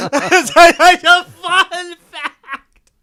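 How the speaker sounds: high, very slow laughing drawl; laughing fun fact. Acted. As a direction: stretch it out while laughing. Laughing mock reply.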